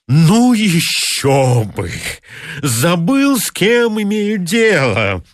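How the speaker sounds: high, slow, breathless mockery; outraged sarcastic pedant. A narrator's reading. Pedant mocking the clerk before the director, high outraged sarcasm. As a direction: choke on indignant sarcasm.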